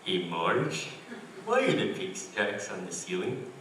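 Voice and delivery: lightly voiced dopey cartoon husband; Homer Simpson. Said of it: "the second one is Homer Simpson".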